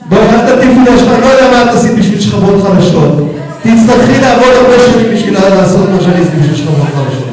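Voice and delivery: fast, loud rebuke; indignant retort. Real, not acted. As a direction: snap back at a heckler, fast and forceful. Angry retort to a heckler.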